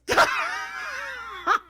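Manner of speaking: a burst of high, helpless laughter; high shrieking laugh. Real, not acted. Husband recalling his wife's blowup, laughing in a high shriek.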